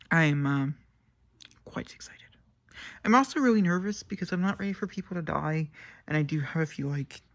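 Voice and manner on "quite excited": hushed thrill, unvoiced; excited whisper. Real, not acted. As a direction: whisper it, barely a breath.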